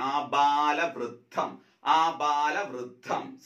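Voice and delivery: ആബാലവൃദ്ധം said very slowly with a clear വൃ; ആ-ബാ-ല-വൃ-ദ്ധം, each syllable drawn out; slow spelling demo. Shown as a demonstration. Teacher to exam students, slow clear ആബാലവൃദ്ധം. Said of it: "ശ്രദ്ധിച്ചല്ലോ?".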